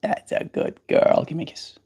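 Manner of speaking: whisper. Real, not acted. Whispered to bird.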